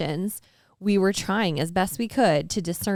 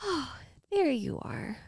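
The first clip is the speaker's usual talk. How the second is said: softer, higher tender greeting "Oh, there you are"; coming home welcome; 6 semitones higher, 5 dB quieter than usual. Retold or imitated. God welcoming her back after her honesty, soft and tender. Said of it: "like, coming home".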